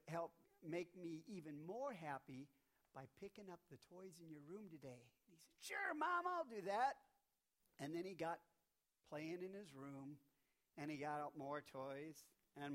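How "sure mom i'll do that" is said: put-on high, louder little-boy voice; eager kid agreeing to his mom; high cheerful kid reply; child's voice. Johnny voiced in story.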